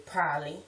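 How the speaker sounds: "probably" squeezed to PROLLY; reduced pronunciation. Shown as a demonstration. Showing how some people say it, "probably" as prolly. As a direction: drop both Bs in "probably". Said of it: "some people say probably".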